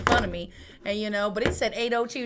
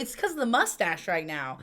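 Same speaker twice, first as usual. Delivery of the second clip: normal talking voice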